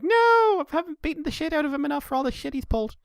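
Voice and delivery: high-pitched mock wail of refusal; acted protest. Recapping a heroine refusing to help Hot Rod, high mock protest. Voicing comic heroine's outburst.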